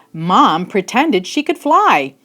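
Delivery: usual speaking voice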